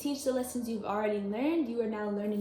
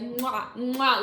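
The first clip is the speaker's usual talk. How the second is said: kissing sounds; 4 dB louder than usual. Retold or imitated. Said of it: "giving you kisses all over".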